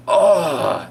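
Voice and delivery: high, drawn-out groan; exasperated groan. Retold or imitated. Patient facing yet another needle, exasperated groan. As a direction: groan in dread and frustration.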